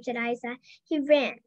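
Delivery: plain reading voice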